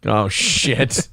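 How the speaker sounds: ordinary talk, nothing marked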